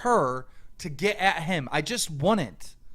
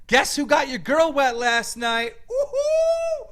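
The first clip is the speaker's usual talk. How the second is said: loud, high jeering whoop; mock provocation, shouted and whooped up high; taunting shout; 3 dB louder, slower than usual. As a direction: holler the taunt to rile him up. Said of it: "I would have said".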